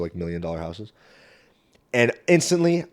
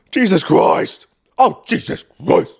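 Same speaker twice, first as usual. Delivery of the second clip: higher, louder exasperated groan of Jesus Christ; 6 semitones higher, 6 dB louder, slower than usual. Real, not acted. Comedian groaning in exasperation at the story. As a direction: drag it out in disbelief.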